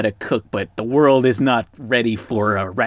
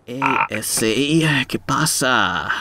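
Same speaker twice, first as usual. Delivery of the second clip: put-on Mexican accent; much slower than usual. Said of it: "I can't even do a Mexican accent".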